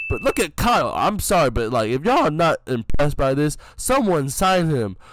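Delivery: high, loud hype-man shouting; hyped-up praise. Real, not acted. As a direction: sell him to the scouts at full volume. Hyping teammate's play.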